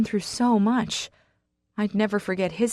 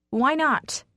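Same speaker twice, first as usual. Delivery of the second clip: snapping; 8 semitones higher, 3 dB louder than usual. Acted. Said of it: "I snap".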